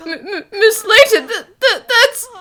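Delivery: alarmed stutter, catching on the first sounds; startled stammer. Acted. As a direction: stammer in shock, words refusing to come. Startled stammer at seeing a look-alike.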